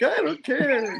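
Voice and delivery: high-pitched comic voice for Ben; high, silly retold protest; horrible character voice. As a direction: squeal it as a mock comic-book character. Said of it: "doing those horrible voices".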